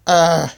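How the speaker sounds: a loud sharp cry; yelled cry. Acted. Yeti struck down in the fight, loud cry. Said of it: "yelled Yeti".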